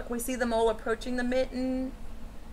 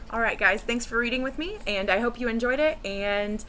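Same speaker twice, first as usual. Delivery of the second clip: ordinary delivery, nothing marked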